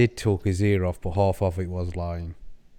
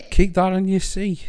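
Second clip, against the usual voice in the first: higher-pitched mock protest; mock indignation; 9 semitones higher than usual. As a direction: send them packing in mock outrage. Mock rant against land lobsters.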